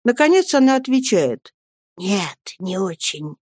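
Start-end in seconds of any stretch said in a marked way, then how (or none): 2.0-3.3 soft, slow hushed answer; quiet, tearful. A narrator's reading. Nanny answering the boy after crying quietly, subdued murmur. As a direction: answer gently, holding sorrow in. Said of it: "Пиготи тихонько плачет".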